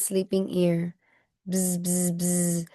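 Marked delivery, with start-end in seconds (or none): 1.5-2.7 quiet bee buzz; soft buzzing. A narrator's reading. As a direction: buzz softly like a bee at his ear.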